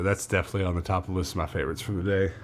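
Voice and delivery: normal talking voice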